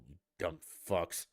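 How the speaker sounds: usual speaking voice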